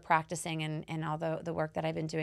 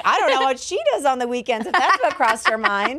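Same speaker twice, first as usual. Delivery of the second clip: excited; 6 semitones higher, 10 dB louder than usual. Retold or imitated. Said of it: "And I'm like".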